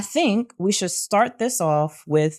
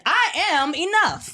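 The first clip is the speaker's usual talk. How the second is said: higher, slower, declaring it; emphatic repeat; 8 semitones higher, slower than usual. Shown as a demonstration. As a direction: say it like you mean it. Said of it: "I'ma say it again".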